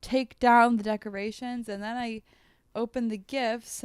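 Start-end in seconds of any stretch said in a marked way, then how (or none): none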